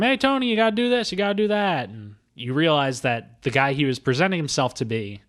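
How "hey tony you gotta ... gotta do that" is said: high, fast, loud boss barking orders; bossy shopkeeper. Retold or imitated. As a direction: nag him like a pushy boss. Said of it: "his boss is telling him".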